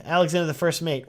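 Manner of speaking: normal talking voice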